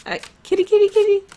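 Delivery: put-on very high Polish-accented cat call; Polish kitty call. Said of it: "You can hear that I'm Polish when I'm saying it".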